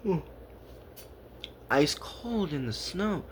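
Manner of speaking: ordinary delivery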